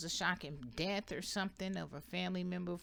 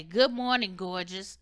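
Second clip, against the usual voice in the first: loud, bright greeting to yourself; cheerful affirmation; 2 semitones higher, 8 dB louder than usual. Shown as a demonstration. Coaching viewers on a mirror affirmation, cheerful. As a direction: greet yourself like someone you adore. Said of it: "Look at yourself in the morning and say".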